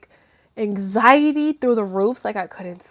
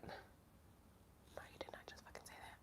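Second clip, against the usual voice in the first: breathy whisper of disbelief; 38 dB quieter than usual. Retold or imitated. To the guy in her story, a stunned whisper. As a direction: hiss it under your breath, stunned.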